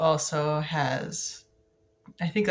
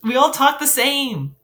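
loud; 9 semitones higher, 7 dB louder than usual. Real, not acted.

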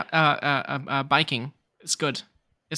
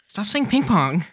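annoyed; 5 semitones higher, 5 dB louder, slower than usual. Real, not acted. Annoyed at repeated word.

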